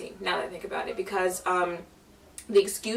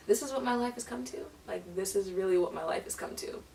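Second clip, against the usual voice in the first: softer, fast, deflated disbelief; dismayed mutter; 8 dB quieter than usual. Retold or imitated. Girl muttering in despair after the fake comfort, deflated and soft. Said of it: "I was like".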